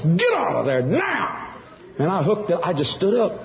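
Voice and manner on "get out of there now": slow, drawn-out urgent command; stretched-out cry, rising in pitch; imitating God's holler. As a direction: holler it as a warning from above. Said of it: "I heard God holler in an audible voice".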